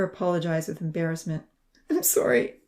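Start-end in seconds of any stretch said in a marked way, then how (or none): 1.9-2.6 high, breathy apology caught in a sob; choking on a sob. A narrator's reading. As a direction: squeeze it out past a sob. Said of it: "choking on another sob".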